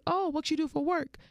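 high-pitched, perky question; acted eager interest. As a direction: play up the curiosity for a new crush. Acting her eager question to him.

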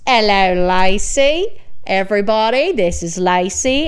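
imitating an Australian friend's loud, drawn-out greeting; big Aussie hello to a livestream audience; Australian accent. Retelling farmer friend's livestream welcome, imitated Australian accent. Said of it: "I just love hearing him talk".

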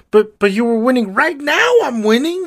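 put-on high, pleading boy-king voice; whiny Ptolemy. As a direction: plead like a clueless preteen. Ptolemy pleading in acted quarrel.

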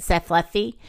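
ordinary delivery, nothing marked

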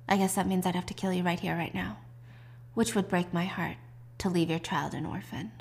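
low-key, soft acted menace; quiet threat. Bree threatening the chairman's life, quiet and controlled. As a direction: let the threat sink softly, understated.

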